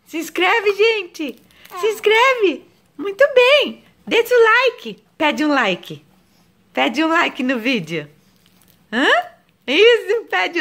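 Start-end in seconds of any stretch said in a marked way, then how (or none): 0.1-5.0 high, loud cooing; mother coaxes baby to ask for likes; baby talk. Acted. 9.7-10.6 high, loud cooing praise; mother praises baby in high voice. Acted.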